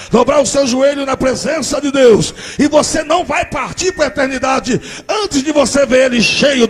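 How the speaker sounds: loud, high, slow pulpit preaching; shouted revival preaching; preaching at full force. Acted. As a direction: exhort the church to believe. Preacher exhorts the congregation.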